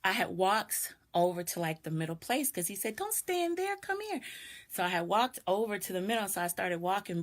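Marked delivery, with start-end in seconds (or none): 3.0-4.2 higher, insistent coaxing voice; mock him pressing her to come in; lightly voiced pushy neighbor, higher; imitating the man's urging. Man urging girl inside again.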